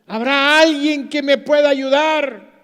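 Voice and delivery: loud, high desperate call; calling for help. Retold or imitated. Climber hanging from a branch calls for help, loud and high. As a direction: plead for anyone to hear. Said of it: "empezó a gritar".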